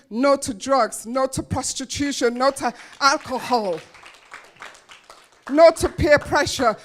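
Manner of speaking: defiant list rising from firm to loud; building defiance. Real, not acted. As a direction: build the refusals to a final shout.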